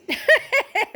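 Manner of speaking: loud high cackle; real laugh; high burst of laughter. Real, not acted. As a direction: laugh out hard at the memory. Laughing at her own story.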